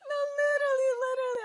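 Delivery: squeaky laughing; laughing high squeal. Real, not acted. Laughing hard.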